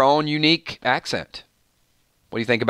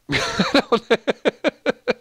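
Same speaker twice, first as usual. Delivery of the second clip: high, helpless laughing fit; 10 semitones higher than usual. Real, not acted. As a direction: crack up in a rising run of giggles. Livestream host cracks up at comment.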